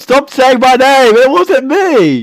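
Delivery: high loud indignant yell; shouting. Real, not acted. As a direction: yell it, denying everything. Yelling at friends.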